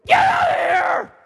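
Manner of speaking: very high, full-force furious shout; screaming. Acted. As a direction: scream to drive them away. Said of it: "Screamed at them".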